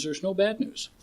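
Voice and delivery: high, soft calm sage; calm master line. Retold or imitated. Retelling the turtle master calming the chicken, serene. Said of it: "Son, there's no terrible news".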